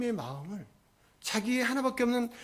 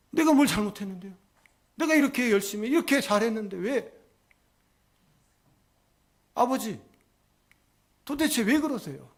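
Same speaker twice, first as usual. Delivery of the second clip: higher-pitched, aggrieved questioning; indignant protest; 4 semitones higher than usual. Retold or imitated. Elder son demanding reasons from his father, aggrieved. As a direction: act out a son who sees no fault.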